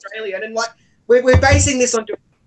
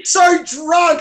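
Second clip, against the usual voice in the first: put-on drunk shout; 7 semitones higher, 3 dB louder than usual. Describing drunk guy.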